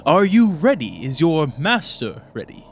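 high-pitched scheming henchman; put-on high conspirator voice; conspirator's voice. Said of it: "one of them goes".

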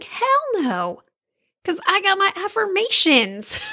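high-pitched, sassy retort; cocky confidence. Retold or imitated. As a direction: brag it playfully, pitched well up. Retells her cocky answer to question.

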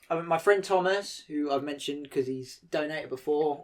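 usual speaking voice

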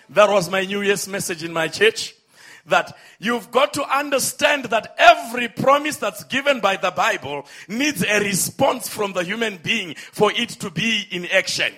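normal talking voice

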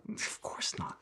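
dry whispered aside. Retold or imitated. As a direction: breathe it out, barely audible. Retold deadpan reply, whispered.

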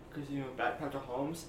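ordinary talk

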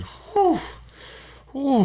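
high, breathy whew after a burning shot; hammed-up gasp as the liquor burns; high laughing whew. Retold second tequila shot.

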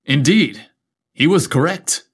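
plain reading voice

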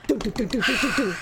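quick run of "doo" syllables; rhythmic pattering sound made with the voice; imitated footsteps. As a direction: mimic little feet approaching. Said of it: "I heard".

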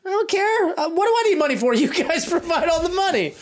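high laughing. Acted. Careless thief laughs it off.

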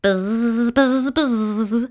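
long repeated bee buzzes; bee buzzing. A narrator's reading. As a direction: buzz like a bee. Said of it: "said the bee".

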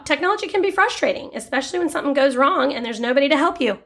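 ad-announcer voice, quick and bright; mock ad read. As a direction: read it like a radio spot.

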